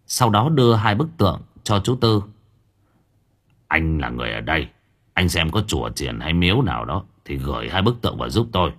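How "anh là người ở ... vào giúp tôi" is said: low, subdued elder's request; old master requesting. A narrator's reading. Old master asking local man to house statues, low request. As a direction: ask a favour in a lower voice. Old Năm asks a favour.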